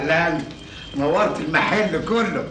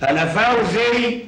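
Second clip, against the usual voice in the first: high, slow, proud self-announcement; boastful; 9 semitones higher, 4 dB louder, much slower than usual. Acted. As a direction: puff up and savour your own name. Boasting of himself. (تفاخر وتباهٍ)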